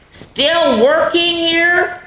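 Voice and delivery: high, slow questioning voice; slow high-pitched character voice; teacher character. Acted. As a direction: ask it slowly, up high, like a curious grown-up. Teacher character asking Splat.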